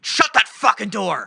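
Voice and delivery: loud, slow high-pitched bellow; retold cop shout. Imitating cop as kid opens door, angry bellow. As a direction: bellow each word as an order. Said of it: "He's like".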